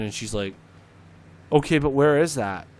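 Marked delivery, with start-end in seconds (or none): 1.5-2.7 louder, a clueless dispatcher's question; mock dispatcher question. Dispatcher asks the caller where the place is, louder and baffled. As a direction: ask it like someone who won't get it.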